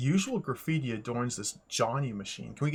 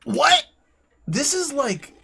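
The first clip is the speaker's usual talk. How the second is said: loud, high shocked "What?"; baffled; 11 semitones higher, 9 dB louder, slower than usual. Real, not acted. Streamer baffled by the game's joke, loud disbelieving cry. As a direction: throw it out in disbelief.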